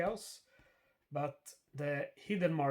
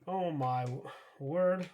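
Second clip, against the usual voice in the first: exasperated disbelief; 4 semitones higher, 7 dB louder than usual. Real, not acted. Bad random event in game.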